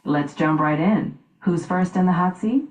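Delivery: normal talking voice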